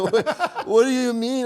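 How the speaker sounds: put-on high, laughing kid's question; laughing child voice. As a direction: ask it through laughter, high.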